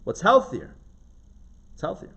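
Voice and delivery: ordinary talk, nothing marked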